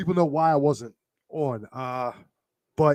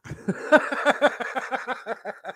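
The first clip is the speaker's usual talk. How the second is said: long, high-pitched chuckle; a real run of giggling laughter; 8 semitones higher than usual. Real, not acted. Laughing at a remembered joke while teary.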